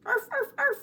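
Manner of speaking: high yappy barks; dog barking. Pretend. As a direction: bark like a little puppy. Dogs barking in a rhyme.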